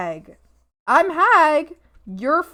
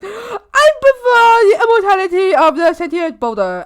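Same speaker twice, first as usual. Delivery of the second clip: higher, loud, laugh-filled declaration; loud laughing proclamation; laughing shout; 7 semitones higher, 4 dB louder than usual. Real, not acted. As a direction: blurt it out through laughter.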